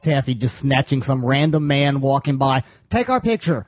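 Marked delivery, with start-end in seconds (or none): 2.9-3.7 high-pitched mock plea to a passerby; mock eager request. Retelling grabbing a random man for a photo, mock eager. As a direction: imitate someone pouncing on a stranger, pitched high.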